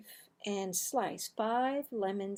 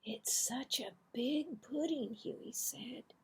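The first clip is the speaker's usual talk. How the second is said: whispered sneaky plea; hushed tempting whisper; 4 semitones higher, 6 dB quieter than usual. Acted. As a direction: whisper it guiltily. Boys sneaking more pudding.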